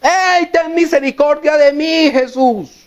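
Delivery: high, sustained acted call, falling on the last word; insistent crying out. Blind beggar calling Jesus over a hushing crowd, acted insistent cry. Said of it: "seguía gritando más fuerte".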